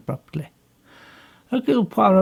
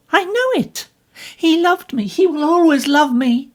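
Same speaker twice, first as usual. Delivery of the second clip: much higher and louder, fervent; passionate insistence; 13 semitones higher, 7 dB louder than usual. Acted. Jacqueline fervently insists to Poirot that Simon loves her. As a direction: cry out her certainty.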